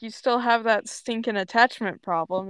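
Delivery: usual speaking voice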